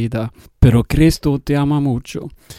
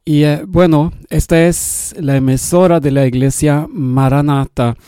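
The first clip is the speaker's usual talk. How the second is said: ordinary talk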